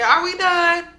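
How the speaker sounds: dismissive retort. Retold or imitated. O G dismissing Jackie, voiced.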